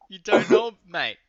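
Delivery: loud, high disbelief through a laugh; shouting at his co-host who doesn't know his own roots; incredulous laughing shout. Real, not acted. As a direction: call out his mate in mock outrage. Teasing co-host about Korean roots.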